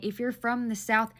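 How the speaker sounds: ordinary talk, nothing marked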